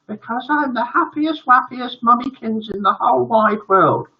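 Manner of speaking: high, cooing mummy voice; gushing mother. Acted. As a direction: gush through happy mock tears. Overjoyed tearful mother answers son.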